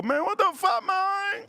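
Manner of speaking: very high squeaky mock voice of friend; imitating friend.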